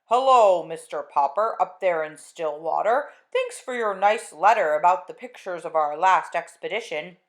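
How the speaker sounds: slow, loud, distant radio announcement; Admiral Drake on radio. Acted. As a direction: broadcast across the world, slowly.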